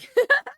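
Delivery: very high, loud squeal of laughter; shrieking laugh. Real, not acted. Streamer cracking up at the idea of a gubby heel, loud high squeal. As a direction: burst into a shrill squeal of a laugh.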